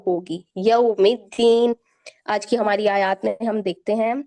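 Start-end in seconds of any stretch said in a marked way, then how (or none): 0.6-1.8 slow, loud यौमिद्दीन with a full au; tajweed demo. Shown as a demonstration. Teacher models the au sound, slow and loud. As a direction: stretch यौमिद्दीन, yau not yo. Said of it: "औ आवाज होगी".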